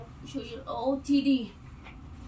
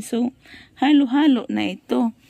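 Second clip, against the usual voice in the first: usual speaking voice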